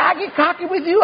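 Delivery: high-pitched retort, rising at the end; defiant comeback. Retold or imitated. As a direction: imitate a wife throwing it back at him. Wife snaps back in quarrel.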